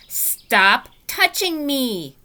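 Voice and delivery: loud, slow angry holler. Acted. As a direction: yell it right in her face. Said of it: "she hollered right in my face".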